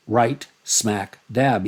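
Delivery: "Right", "smack", "dab" each hit; three stresses. Shown as a demonstration. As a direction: punch every word. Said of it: "Each one of those is accented".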